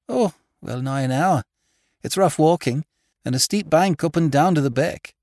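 high, loud country woman testifying; high-pitched, emphatic rustic woman; stark farmer's wife. A narrator's reading. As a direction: insist on the facts as a countrywoman. Farmer's wife answering lawyer's questions.

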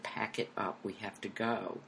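soft, low firm order; hushed insistence. Retold or imitated. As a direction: repeat it quietly and firmly. Producer repeats the hushed order.

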